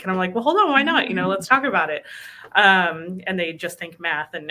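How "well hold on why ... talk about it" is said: upbeat reply. Retold or imitated. Recruiter answering doubtful candidate.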